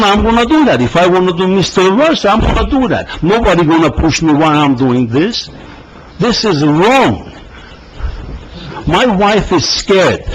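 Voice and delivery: pleading and outraged, pitch rising; rising upset. Real, not acted. Resident protesting police harassment at council.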